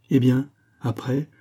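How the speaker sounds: ordinary delivery, nothing marked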